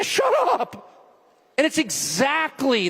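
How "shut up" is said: high, drawn-out mock retort; mocking dismissal. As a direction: imitate someone cutting off a question. Mimics opponent's dismissal.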